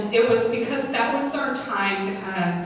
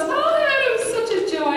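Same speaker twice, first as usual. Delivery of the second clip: much higher, gushing and sweet; gushing daycare lady; 14 semitones higher, 3 dB louder than usual. Retold or imitated. Daycare teacher at the door about Adam, high gushing voice. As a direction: coo it like a delighted caregiver. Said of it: "she goes".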